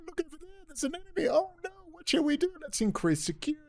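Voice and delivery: squeaky mock-alarm of the powers that be; mock officials. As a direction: parody scared officials, high and breathless. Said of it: "creating enemies and then going".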